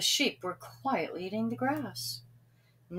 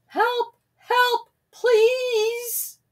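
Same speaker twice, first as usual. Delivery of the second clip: terrified cry; 7 dB louder, much slower than usual. A narrator's reading. Terrified Sam runs shouting.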